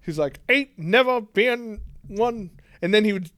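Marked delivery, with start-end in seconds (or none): 0.5-2.5 slow, higher mock Southern drawl over British; muddled British-Southern accent. Co-host mimicking the actor's accent, muddled British-Southern. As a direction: imitate a Brit attempting a Southern drawl. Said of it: "British accent, but with Southern inflections".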